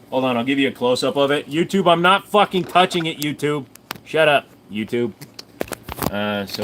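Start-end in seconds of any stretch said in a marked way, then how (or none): 1.5-5.1 higher and louder, annoyed at YouTube; real frustration shouted at YouTube; exasperated protest. Real, not acted. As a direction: snap back at the platform. Protesting to YouTube about a ban.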